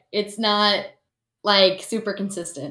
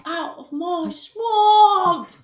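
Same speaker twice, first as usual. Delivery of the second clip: slow, higher, louder ogre bellow; hammed-up ogre guarding his swamp; Shrek impression; 9 semitones higher, 4 dB louder, much slower than usual. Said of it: "what is it that he says".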